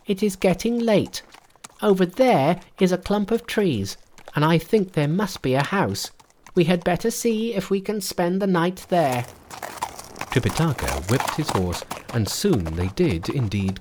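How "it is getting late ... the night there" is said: high, bright monkey king voice. A narrator's reading.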